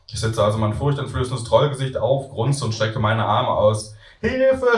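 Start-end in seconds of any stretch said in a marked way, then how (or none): 4.2-4.8 high, loud playful cry for help; a boy pretending to be scared; mock scared scream. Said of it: "schreit der Junge".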